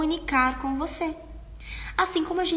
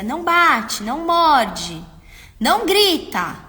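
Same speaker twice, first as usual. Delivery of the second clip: loud, higher, slow scolding; yelling parent; 5 semitones higher, 9 dB louder, slower than usual. Shown as a demonstration. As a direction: shout the rules at the child. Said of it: "mas você tá gritando". (pai gritando com a criança)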